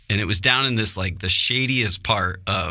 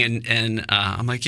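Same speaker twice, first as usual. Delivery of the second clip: normal talking voice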